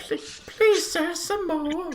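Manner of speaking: piercingly high, hammed-up begging; pleading waif voice. Tagalong character begs a player, hammed-up high pleading. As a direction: beg in a squeaky falsetto, slowing at the end.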